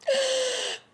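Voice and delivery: a high shocked intake of breath; sharp gasp. Real, not acted. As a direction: gasp as if the gun were pointed at you. Reacting to the gun scene.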